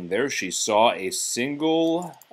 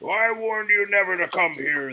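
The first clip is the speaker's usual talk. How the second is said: high, raised, roaring warning; furious yell; 9 semitones higher than usual. Acted. The beast yelling at Belle by the rose. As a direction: yell him out of the wing. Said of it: "the beast yelled".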